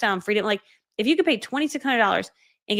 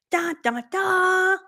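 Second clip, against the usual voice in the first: sung fanfare; 9 semitones higher, much slower than usual. Pretend. Fanfare before birthday price.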